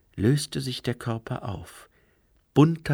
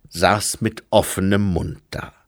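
usual speaking voice

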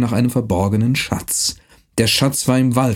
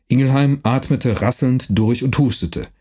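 ordinary talk

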